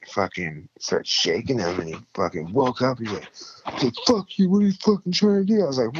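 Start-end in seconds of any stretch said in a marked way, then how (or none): 4.1-5.6 much higher, louder snarling junkie voice; angry junkie. Retold or imitated. Overdosed man yelling at his rescuer, much higher and louder. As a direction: bark it like a man woken from an overdose.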